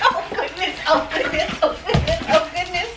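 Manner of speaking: high, laughing, overjoyed; excited. Real, not acted. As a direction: gush with delight. Greeting her dog after days away.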